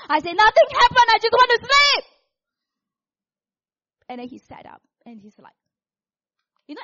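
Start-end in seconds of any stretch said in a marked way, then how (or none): 0.4-2.0 high-pitched, very loud outburst; frustrated yelling. Retold or imitated. Wife to startled husband at night, frustrated yelling. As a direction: yell it back in exasperation.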